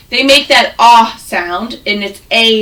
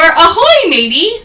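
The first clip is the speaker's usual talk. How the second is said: pirate greeting; 7 semitones higher than usual. Shown as a demonstration.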